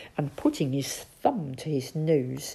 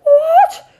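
very high, loud squeak of alarm; Toad, alarmed; 17 dB louder than usual. Acted. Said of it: "stammered Toad".

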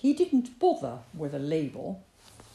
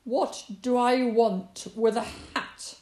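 scornful fox; 3 semitones higher, 7 dB louder than usual. A narrator's reading.

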